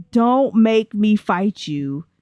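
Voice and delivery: drawn-out, word-by-word mock threat; slow mock warning. Player warning a game character over mac and cheese, slow mock threat. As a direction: stretch out each word as a playful warning.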